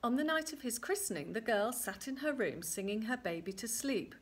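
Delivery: plain reading voice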